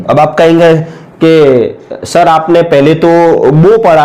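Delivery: ordinary delivery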